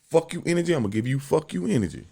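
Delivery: ordinary talk, nothing marked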